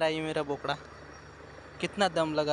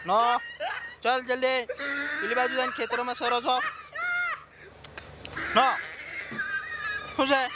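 laughing shout; 8 semitones higher than usual. Real, not acted. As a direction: shout at the goat through laughter. Calling to goat, laughing.

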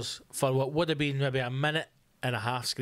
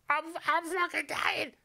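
strained, much higher, choked cry; dying patient; 13 semitones higher than usual. Retold or imitated. Comic voicing a dying patient calling for help, strained and high. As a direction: croak it out like a choking man on a ward. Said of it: "Choking out, going".

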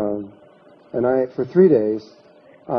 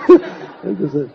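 laughing; 5 semitones higher, 5 dB louder, much slower than usual. Real, not acted. Laughing at the man leaving.

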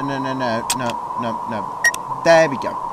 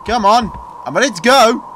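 loud taunt; 8 semitones higher, 5 dB louder than usual. Real, not acted. Taunting monster in horror game.

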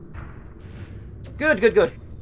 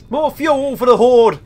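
loud, drawn-out rallying shout; hammed-up horde cheer; mock battle cry; 4 semitones higher, 10 dB louder, slower than usual. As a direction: bellow it slowly like a war cry. Mock battle cry while playing.

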